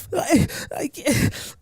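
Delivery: high, slow, thin crying voice; mock a girl sobbing out her words; imitating crying girl. Retelling a classmate who came in crying, mock sobbing. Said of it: "like, fucking crying".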